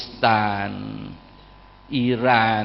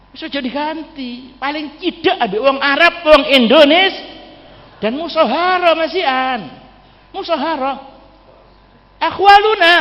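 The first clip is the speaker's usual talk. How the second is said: much higher, louder impassioned sermon peak; fervent preaching; 6 dB louder than usual. Acted. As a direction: proclaim it proudly to the congregation. Preacher's fervent climax on kinship.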